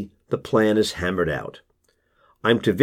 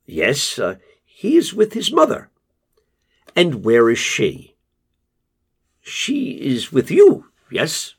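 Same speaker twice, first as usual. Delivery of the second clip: Casper hedging to the father, higher and level; Casper's voice; 3 semitones higher than usual. Acted. Narrator voices Casper on phone.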